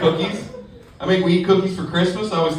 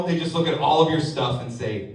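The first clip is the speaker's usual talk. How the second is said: ordinary talk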